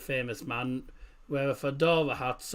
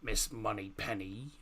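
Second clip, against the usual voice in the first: put-on slow, low Bond accent on Moneypenny; 5 semitones lower, 8 dB quieter than usual. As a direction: drawl Miss Moneypenny like Bond. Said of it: "That's so bad".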